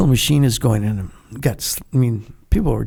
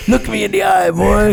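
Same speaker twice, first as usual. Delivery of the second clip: hint of a barking drill instructor, louder and higher; 7 semitones higher, 5 dB louder than usual. Wounded Marine mocking a recruit: loud drill instructor bark. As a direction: play the tough Marine recruiter growling at a recruit.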